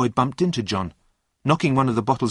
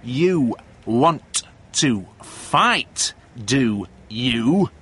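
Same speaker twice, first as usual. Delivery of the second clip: very slow "you... want... to... fight", each word punched; "you want to fight, do you" in separate, heavy beats; word-by-word threat; 4 dB louder, much slower than usual. A narrator's reading. Steve shoves John with each word, threatening "you want to fight". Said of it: "As he said each word, he pushed".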